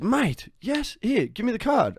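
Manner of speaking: imitating a friend who is thrilled; eager retold excitement. Retelling friend's eager grab for card.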